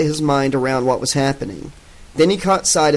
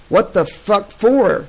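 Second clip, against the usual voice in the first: higher, slow angry demand; 7 semitones higher, slower than usual. Acted. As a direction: push the anger up and drag it out. Soldier grows angrier.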